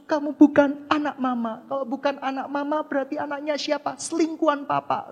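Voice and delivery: stern parent. Retold or imitated. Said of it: "Orang tua kita untuk melarang kita".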